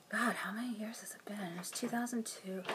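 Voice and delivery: unvoiced aside to herself; whispered aside. Real, not acted. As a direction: whisper it under your breath.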